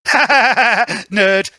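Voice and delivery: high cackle bursting into "Nerd!"; laughing Spring. Acted. Spring laughing at Otto, loud and high. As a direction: laugh loud and mock him. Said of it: "Spring begins to laugh".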